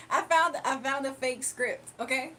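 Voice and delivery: normal talking voice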